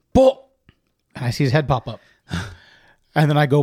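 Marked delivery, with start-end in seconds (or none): 0.1-0.4 loud high call across a field; yelling a dog's name across a big yard. Retold or imitated. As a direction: shout the dog's name from far off. Calling dog across acre.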